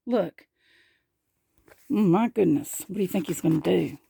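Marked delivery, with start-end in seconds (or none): none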